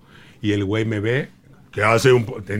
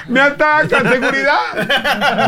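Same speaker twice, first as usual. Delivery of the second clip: much higher, louder panicked falsetto; in-character screaming lady; 12 semitones higher, 4 dB louder than usual. Retold or imitated. Comedian in drag fleeing the angry groom, panicked falsetto. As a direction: shriek for help while fleeing. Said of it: "no perdí el personaje".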